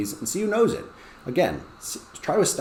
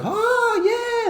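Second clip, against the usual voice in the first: put-on very high squeal of kids; delighted kids. Winning team reacting, very high squeal. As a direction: squeal like excited winners. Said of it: "team four's like".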